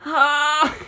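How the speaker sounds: high yelp; short startled yelp; yell. A narrator's reading. Navigator yelling while throwing the bomb, startled. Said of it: "Jim yelled".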